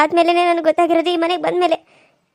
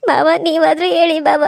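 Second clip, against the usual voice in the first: tearful plea, voice breaking into sobs; crying. Acted. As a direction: weep as she begs not to be parted. Begging father-in-law, weeping. (ಅಳುತ್ತಾ ಬೇಡಿಕೊಳ್ಳುವುದು)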